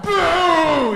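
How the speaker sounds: high shouted mock boo. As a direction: bellow a silly high boo. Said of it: "He's like".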